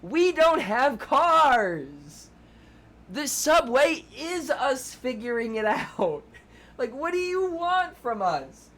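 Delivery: outraged rising complaint; exasperated rant. Real, not acted. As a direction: vent at the city that left you stranded. Ranting about the city's subway.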